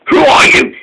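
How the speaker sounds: high, loud, breathless who are you; shout. A narrator's reading. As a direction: challenge the stranger. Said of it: "shouted Harry".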